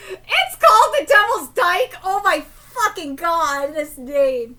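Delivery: howling with real laughter at the name; laughing hard. Real, not acted. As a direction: shriek it out through helpless laughter. Cracking up at place name.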